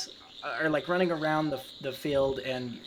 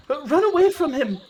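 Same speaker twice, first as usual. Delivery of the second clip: high, loud warning shout; acted yell; 13 semitones higher, 9 dB louder than usual. Mother yells to the other two children, urgent acted shout. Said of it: "yells to the other two children".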